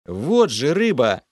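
loud, slow growled exclamation; angry growl. A narrator's reading. Hero snarls at the cowardly water spirit, angry. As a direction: snarl it out, pointing accusingly. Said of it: "Зло рыкнул я".

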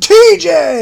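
high, loud name call; hype-man shout. Shown as a demonstration. Co-host showing a hype man cutting into an episode, shouted name. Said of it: "hundred random shouts".

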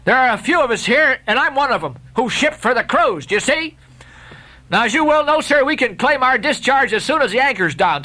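firm sailor bargaining, pitched high; high, pleading Lakeman. Acted. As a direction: argue it out with the captain. Lakeman argues with the captain.

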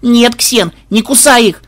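high alarmed yell; frantic high-pitched cry. A narrator's reading. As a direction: plead loudly to stop him. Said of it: "завопила внучка".

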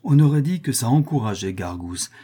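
ordinary delivery, nothing marked

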